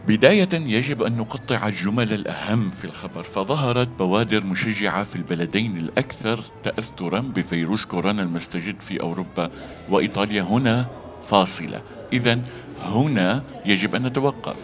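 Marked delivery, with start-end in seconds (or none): none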